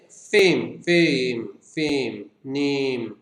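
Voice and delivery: फेम and नेम each drawn out slowly as one unbroken sound; one-syllable demo. Shown as a demonstration. Teacher showing one syllable per word, फेम and नेम held long. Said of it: "एक ही, एक ही sound आ रही है".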